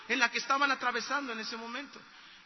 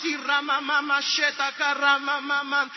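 louder, rhythmic tongues syllables; speaking in tongues; 5 dB louder, slower than usual. Retold or imitated. Preacher imitates the boy speaking in tongues, loud rhythmic syllables. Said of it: "empezó a hablar en nuevas lenguas".

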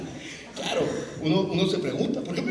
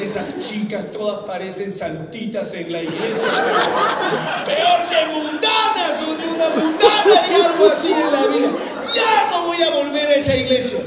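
loud, high mocking complaint; angry young man ranting about church girls, loud and high; retold rant; 8 semitones higher, 8 dB louder than usual. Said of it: "Yo decía".

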